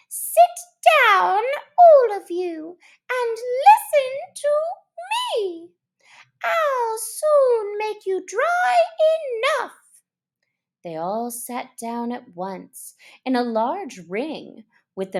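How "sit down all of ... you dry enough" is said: high, loud squeaky mouse voice; Mouse calling out. Acted. Mouse calling the wet animals to sit, loud and high. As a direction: call the room to order like a tiny chairman. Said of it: "called out".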